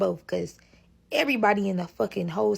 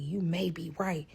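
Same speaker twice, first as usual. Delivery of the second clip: whispered; 3 semitones lower, 7 dB quieter than usual. Retold or imitated. Cop conceding secretly.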